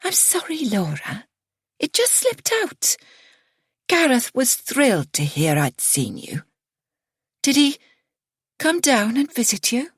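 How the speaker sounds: put-on high, apologetic elderly mother; sorry but eager to pry. A narrator's reading. Mother's voice in story.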